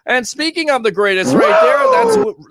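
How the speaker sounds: sudden high hype yell; wrestling hype scream; hype shout. Real, not acted. Hyping Ric Flair clip.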